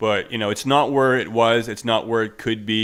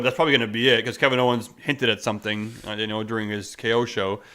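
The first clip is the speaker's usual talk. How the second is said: ordinary talk, nothing marked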